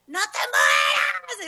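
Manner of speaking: high, drawn-out desperate cry; reenacted frantic plea; panicked scream. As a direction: scream it in mock panic, stretching it out. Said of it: "yo me asusté mucho".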